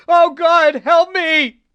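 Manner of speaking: high, loud, panicked screech; a man cracking up, screeching for help. A narrator's reading. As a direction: shriek it out in terror. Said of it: "he screeched".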